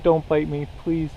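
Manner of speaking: ordinary talk, nothing marked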